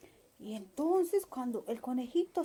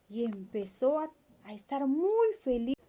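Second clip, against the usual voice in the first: plain reading voice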